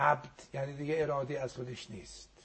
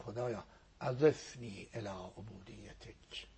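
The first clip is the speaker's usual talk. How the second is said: quiet prayerful plea; 5 semitones lower, 8 dB quieter, slower than usual. Retold or imitated. Quoting Prophet's prayer in Miraj.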